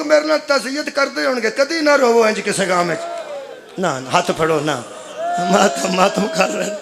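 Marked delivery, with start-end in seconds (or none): none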